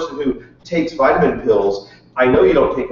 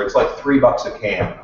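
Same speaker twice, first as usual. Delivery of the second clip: normal talking voice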